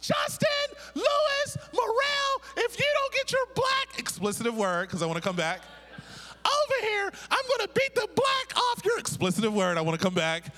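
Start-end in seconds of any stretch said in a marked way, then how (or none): 0.0-4.0 mother's screeching yell. Retold or imitated. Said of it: "I hear this screeching voice". 6.5-9.0 much higher, louder furious shriek across a crowd; mother's screeching yell. Retold or imitated. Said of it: "I hear this screeching voice".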